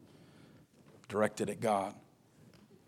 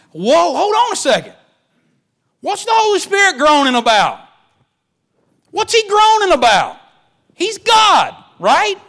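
preaching at full force; 15 dB louder than usual. Acted. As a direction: shout it out from the pulpit, rising high. Preaching climax.

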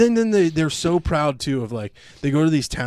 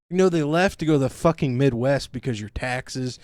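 ordinary talk

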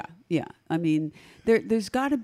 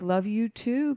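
normal talking voice

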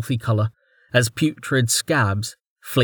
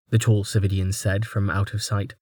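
plain reading voice

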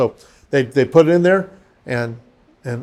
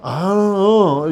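slow, drawn-out higher moan; groggy wife's reply; 4 semitones higher, much slower than usual. Retold or imitated. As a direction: imitate someone barely waking, mouth slack. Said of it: "Her mouth just go".

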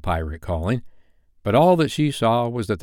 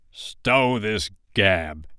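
roaring Hook; much slower than usual. Acted. Hook cutting off the boys' chatter, slow restrained roar. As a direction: draw it out as a menacing pirate captain. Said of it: "roared Hook".

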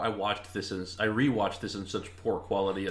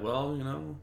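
mocking; 6 semitones higher, slower than usual. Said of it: "He, like, mocks her".

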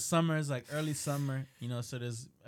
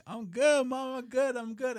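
reassuring mom; 10 semitones higher, 4 dB louder than usual. Retold or imitated. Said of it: "and I'm like".

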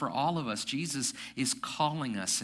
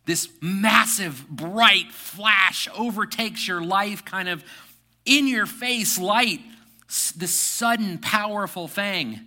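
awed; 6 semitones higher, 8 dB louder, slower than usual. Real, not acted. Describing a lightning-like flash.

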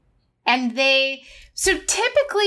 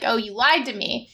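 ordinary delivery

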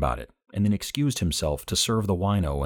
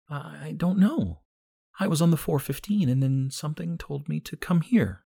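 put-on young woman June; 10 semitones higher than usual. A narrator's reading. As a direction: voice June high and dreamy. June voiced by narrator.

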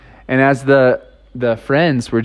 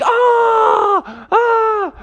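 much higher hammed-up yelps; mock screams. Friends thrown into the river, mock high yelps. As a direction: yelp like tumbling into the river.

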